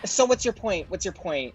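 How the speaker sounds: a lightly hammed-up housewife brushing off a friend; Ramona impression. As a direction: cut her off with mock impatience. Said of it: "you must play Ramona".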